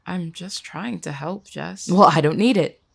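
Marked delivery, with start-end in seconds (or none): 1.9-2.8 loud, irritated cut-in; angry rebuff. Acted. Jess rejects help after bad meeting, angry. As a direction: shut down the offer of help, loudly.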